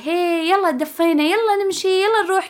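put-on high singsong cheering-on, as to a toddler; coaxing a small child. Nervous driver cheers herself on like a child, high-pitched coaxing. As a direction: imitate hyping yourself up like a little kid. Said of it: "أبدأ عامل نفسي زي البزر".

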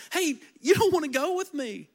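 lightly voiced Naomi shooing them off; pushy Naomi. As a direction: brush them off casually, a hint of pushiness. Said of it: "She's trying to push them".

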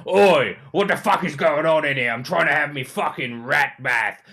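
loud, harsh brute of a woman; a coarse barbarian woman yelling at intruders in her bath; ugly voice. Acted. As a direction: snarl it like a filthy bath-dwelling brute. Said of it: "she says in an ugly voice".

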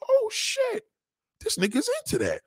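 very high squeal of surprise; mock shocked woman. Woman realizing her man likes it, squealing high. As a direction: gasp it out, scandalized.

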